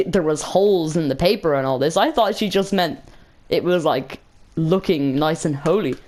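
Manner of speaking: ordinary talk, nothing marked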